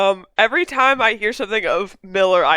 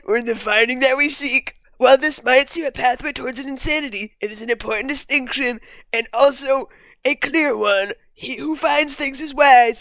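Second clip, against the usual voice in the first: higher, hammed-up Trump impression reading an essay; 4 semitones higher than usual. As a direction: read it like Trump giving a speech. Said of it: "I'm gonna read it in a Trump voice".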